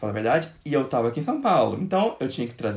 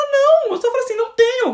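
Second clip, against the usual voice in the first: mock mother refusal; 6 dB louder than usual. Mother's curt refusal voiced.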